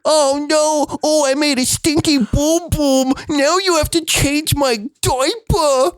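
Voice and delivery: put-on high whiny toddler; baby voice. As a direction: whine like a little kid. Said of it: "you're not gonna do the baby voice".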